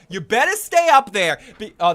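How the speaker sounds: ordinary talk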